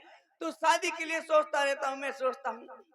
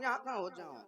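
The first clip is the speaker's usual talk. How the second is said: put-on drunken slur; 3 semitones lower, 6 dB quieter, much faster than usual. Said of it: "तो मैं नशे में रहूं".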